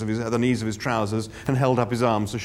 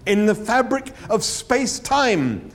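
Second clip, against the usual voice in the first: slow and distinct; 10 semitones higher, slower than usual. A narrator's reading. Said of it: "as slowly and distinctly and patiently".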